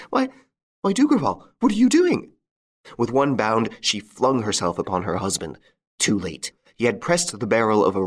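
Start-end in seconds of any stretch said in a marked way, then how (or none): 0.1-2.3 very high, panicked cry; alarmed shriek. A narrator's reading. Wife seeing her husband about to shoot himself, shrieking.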